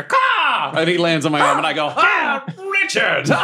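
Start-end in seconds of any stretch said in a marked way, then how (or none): 0.0-0.7 high imitated caw; mock bird caw. Player making the falcon call back, high caw. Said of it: "then we hear". 2.0-3.3 high slow shouted greeting; joyful shout. Acted. Player greeting the revived falcon, high joyful shout.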